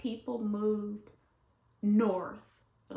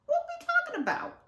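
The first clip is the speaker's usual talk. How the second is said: high, cracking incredulous cry; mock outraged squeal; indignant disbelief; faster than usual. Indignant exclamation, cry heard.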